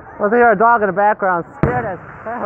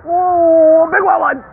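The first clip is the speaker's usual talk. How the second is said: usual speaking voice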